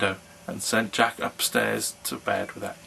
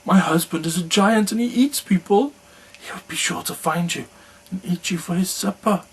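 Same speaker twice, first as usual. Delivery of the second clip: anxious warning; 8 semitones higher, 3 dB louder than usual. A narrator's reading. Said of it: "cried the woman".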